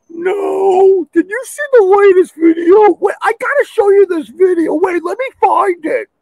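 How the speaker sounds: very high falsetto babble; mock TikTok airhead. Scroller brushes off alien news, high silly falsetto. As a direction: chatter it in a silly squeaky voice.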